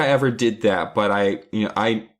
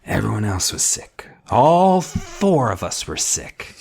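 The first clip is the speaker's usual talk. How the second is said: put-on sick accusing kid; 8 semitones higher than usual. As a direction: play a suspicious sick girl, then whisper the accusation. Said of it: "Judith is just like".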